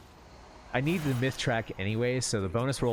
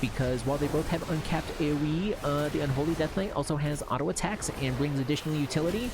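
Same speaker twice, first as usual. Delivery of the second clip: higher, nasal-free know-it-all nerd; 7 semitones higher than usual. Retold or imitated. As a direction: lecture it like a smug theorycrafter. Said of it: "my nerd voice".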